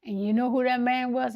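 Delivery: usual speaking voice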